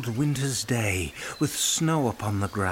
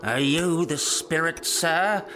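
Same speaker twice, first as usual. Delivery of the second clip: startled Scrooge; 2 semitones higher than usual. A narrator's reading. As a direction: ask the ghost with a catch of alarm. Scrooge questioning the spirit.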